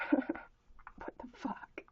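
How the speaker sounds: breathless stunned whisper; slow, hushed disbelief; whispered aside. Real, not acted. Muttering to herself at the screen, whispered.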